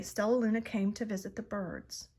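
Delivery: ordinary delivery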